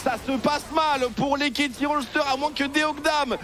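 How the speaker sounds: fast rising play-by-play call; esports caster. Real, not acted. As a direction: call the teamfight as it builds. Live play-by-play building up. (commentateur esport survolté)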